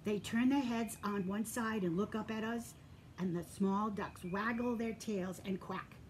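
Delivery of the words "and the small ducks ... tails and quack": ordinary delivery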